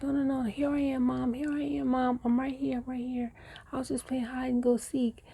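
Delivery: put-on a kid rushing to say she's fine; child calling out.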